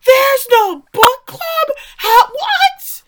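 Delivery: high, loud, drawn-out mock shriek; incredulous husband losing it; mind-blown disbelief. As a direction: explode in hammed-up bewilderment. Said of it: "Jeff's mind just blows up".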